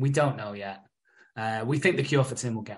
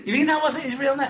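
ordinary talk, nothing marked

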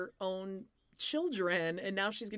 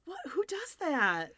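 outraged; 8 semitones higher than usual. Real, not acted. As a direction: draw it out in disbelief. Outraged at her pants.

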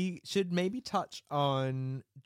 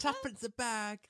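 high-pitched mock cockney street-vendor cry; market seller; 10 semitones higher than usual. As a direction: hawk fruit like a market trader. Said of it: "selling things by the pound".

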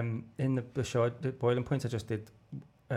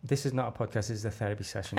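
usual speaking voice

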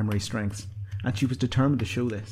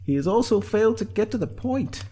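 high-pitched, louder figure voice; Sinead's character voice; 5 dB louder than usual. A narrator's reading. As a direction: pitch it well above the narration.

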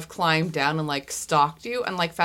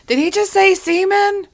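loud, high incredulous yell; high-pitched shouted question; yelling from a rooftop; 8 dB louder than usual. Acted. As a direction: holler it down from the roof. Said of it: "Dee Dee on top of the roof yells".

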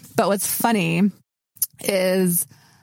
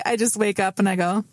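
usual speaking voice

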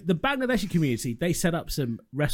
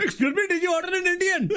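high, laughing mock Indian accent; hammed-up Indian accent with a head wobble. Friend helps retell the delivery man in a mock Indian accent. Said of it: "thanks for the assist on that one".

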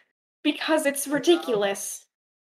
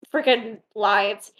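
normal talking voice

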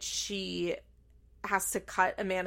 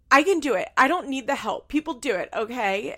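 loud, higher protest, easing toward the end; mock defiant; 5 semitones higher, 6 dB louder than usual. Imitating a daughter insisting to her dad, defiant. Acts out daughter arguing back.

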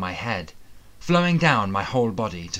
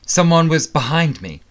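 startled; 7 semitones higher, 9 dB louder than usual. A narrator's reading. Sudden attacker in the mirror.

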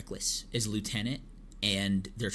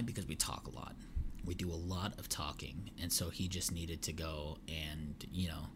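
hushed aside; 3 semitones lower, 9 dB quieter than usual. Real, not acted. Podcaster whispers to listeners while his co-host is away, hushed. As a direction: drop to a soft whisper, confiding.

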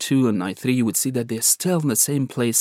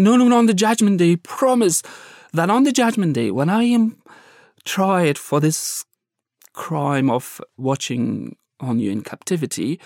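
high, urgent, begging guard; desperate pleading captor; 3 semitones higher than usual. Retold or imitated. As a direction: beg him earnestly for pardon. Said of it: "he's so serious, he's jolting me".

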